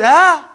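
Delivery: high, loud lost "ah"; a groan of a soul with no answer; helpless groan. Acted. Dead man with no answer, high helpless groan. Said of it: "But he says".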